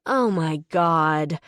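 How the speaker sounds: fed up, dragging it out; exasperated. Acted. Woman fed up with the teasing stranger, exasperated. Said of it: "I roll my eyes, exasperated".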